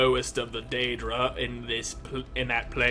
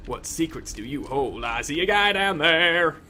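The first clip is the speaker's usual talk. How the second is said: usual speaking voice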